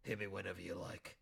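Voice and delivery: a creepy taxidermist pig purring offers; deep voice. Pretend. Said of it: "that kind of deep".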